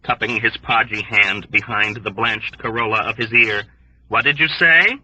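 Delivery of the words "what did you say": shouting over noise. A narrator's reading.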